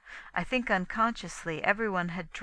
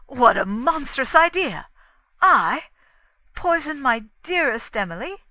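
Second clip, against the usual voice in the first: loud, high outraged protest; indignant cry; 8 semitones higher, 12 dB louder than usual. A narrator's reading. Inglethorpe protesting his innocence, crying out. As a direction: cry out, appalled at the charge. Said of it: "cried Inglethorpe, starting up".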